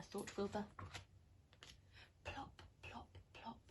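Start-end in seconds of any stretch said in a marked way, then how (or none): 2.2-3.6 soft plops. Acted. Sound of rain dripping.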